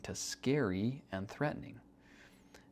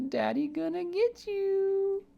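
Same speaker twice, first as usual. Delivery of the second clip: put-on much higher, slow little-girl voice; imitating his daughter; 4 dB louder, slower than usual. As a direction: squeak it like a toddler chasing daddy. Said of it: "She runs up to me and says".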